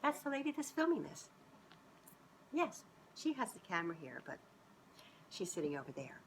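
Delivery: usual speaking voice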